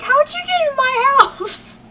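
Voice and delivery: mock confused squeal. Retelling the creep story again, a high teary squeal. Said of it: "It was like".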